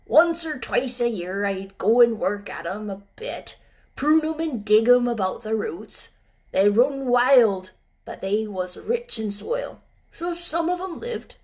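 put-on gruff old gardener in dialect. Ben speaks.

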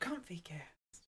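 breathy whisper. Pretend. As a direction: whisper the name slowly. Whispered joke title.

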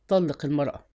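low, restrained command. A narrator's reading. Gang chief ordering the baker to divorce, commanding. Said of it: "ثم قال بنبرة آمرة".

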